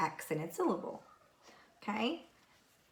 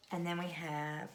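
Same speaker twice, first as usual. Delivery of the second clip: ordinary talk, nothing marked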